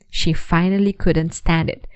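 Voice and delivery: plain reading voice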